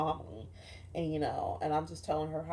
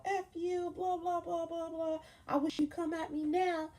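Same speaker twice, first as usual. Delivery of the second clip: much higher mock voice, cocky and taunting; mock defiant retort; 11 semitones higher than usual. Teen girl taunting a rival before a fight. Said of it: "I was just like".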